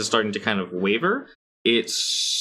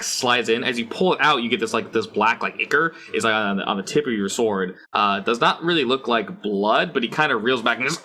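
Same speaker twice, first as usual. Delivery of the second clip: ordinary delivery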